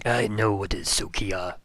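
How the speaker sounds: low, lightly voiced sneering necromancer; a hint of a gloating dark voice. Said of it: "I have one necromancer voice".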